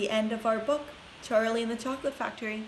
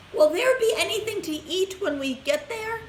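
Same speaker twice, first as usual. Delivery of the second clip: put-on quavery old woman; 10 semitones higher, 4 dB louder than usual. A narrator's reading. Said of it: "asked Grandma Josephine".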